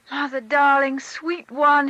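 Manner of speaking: put-on loud, high, joyful child's shout; a boy flinging himself at her. A narrator's reading. Boy hugs his mother, shouting for joy. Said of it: "he shouted".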